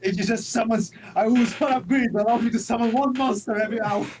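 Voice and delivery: loud, high mock declaration; mock card-player voice. Co-host mocking a Pot of Greed player, loud declaration.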